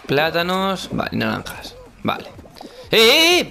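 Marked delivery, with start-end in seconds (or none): 2.9-3.5 loud high yelps; alarmed shout. Real, not acted. Gameplay panic shout.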